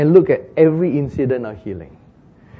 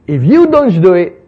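loud preaching emphasis; 4 semitones higher, 9 dB louder than usual. Real, not acted. Preaching climax.

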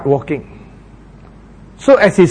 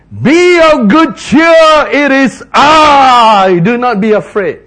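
slow, loud, higher proclaiming voice; Jesus calling out; 7 semitones higher, 7 dB louder, slower than usual. Acted. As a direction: stretch it out as a calm command over the storm. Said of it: "He said".

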